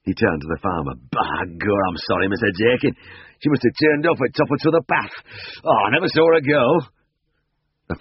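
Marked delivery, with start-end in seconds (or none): 1.1-6.6 a hint of a flustered drover, higher and quick; Yorkshire drover. A narrator's reading. Drover apologising to the farmer, quick and flustered.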